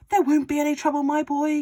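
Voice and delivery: high, loud, gleeful father; excited Mister Cranky. A narrator's reading. Father urging George on about the medicine, high and loud. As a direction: crow it like a man with a scheme. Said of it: "cried Mister Cranky".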